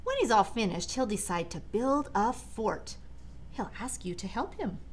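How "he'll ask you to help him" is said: usual speaking voice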